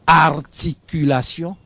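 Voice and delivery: articulation said slowly, syllable by syllable; slow careful articulation. Shown as a demonstration. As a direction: stretch articulation out, one syllable at a time. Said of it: "il prononce syllabe par syllabe".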